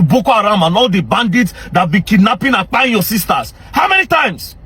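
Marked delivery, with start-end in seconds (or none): 3.7-4.5 angry demand. Real, not acted. Scolding rant at northern critic.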